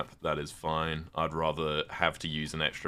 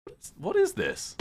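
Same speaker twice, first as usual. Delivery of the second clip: puzzled; 11 semitones higher, 3 dB louder than usual. Real, not acted. Puzzled by a strange object.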